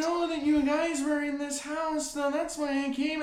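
raspy neighbor impression. Imitating the neighbor's grating voice, high and strained. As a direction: rasp it out like bad acting. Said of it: "her voice is like a sandpaper dildo".

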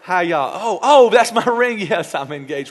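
loud, giddy, with laughs; gushing excitement. Acted. Newly engaged woman greeting church, gushing. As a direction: show off the ring bubbling over. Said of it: "She gonna say to me".

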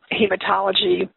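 usual speaking voice

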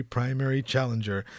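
ordinary talk

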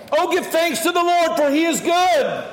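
high, ringing call to give thanks; exuberant praise shout. Shown as a demonstration. Preacher rousing the congregation to thank God, exuberant praise shout. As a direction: proclaim it with full-voiced passion.

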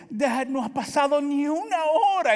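high, loud daughter's complaint; whiny daughter. Retold or imitated. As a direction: whine like a worn-out kid.

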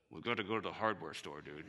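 very low, quiet, held-in temper; restrained frustration. Retold or imitated. Narrator to friend after the belt breaks, restrained frustration. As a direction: keep the temper down, low and soft. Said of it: "as I resisted the urge to pitch the wrench".

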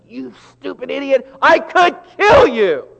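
high, loud enraged shout; furious playground yell. Retold or imitated. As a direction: scream it at the kid who crossed you. Yelling at somebody on playground.

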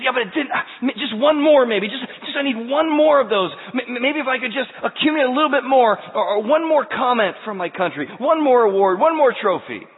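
high, loud, desperate voice of the restless heart; craving inner voice. Acted. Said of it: "that says".

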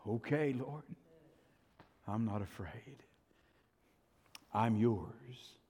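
hushed, submissive; meek surrender. Acted. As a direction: answer the Lord quietly and humbly. Acted meek answer to the Lord.

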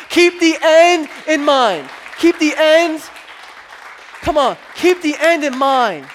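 loud, high, forceful refrain; emphatic preaching. Real, not acted. Preacher urging congregation through hardship, loud emphatic exhortation. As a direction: drive the slogan home to the crowd. Preacher driving home main point.

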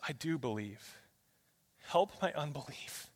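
very soft, earnest confession; hushed admission and request; humble plea. Acted. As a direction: pray it quietly, almost to yourself. Father's humble plea to Jesus.

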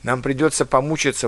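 ordinary talk

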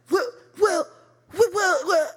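high-pitched mock sputtering; flustered stammer. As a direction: imitate him stuck for an answer, voice high. Boyfriend flustered, lost for answer.

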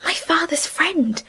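soft, hushed plea; pleading. A narrator's reading. Emily pleading with Miss Jethro, softly. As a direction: beg her gently in the dark. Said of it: "Emily pleaded".

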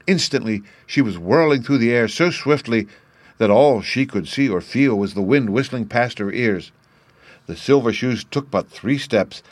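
plain reading voice

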